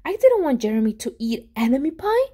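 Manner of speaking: plain reading voice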